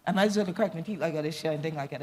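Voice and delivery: fast switch into Gullah. Real, not acted. Speaker giving up on global language, quick Gullah. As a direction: slip quickly into Gullah native speech. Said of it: "just speak my native tongue".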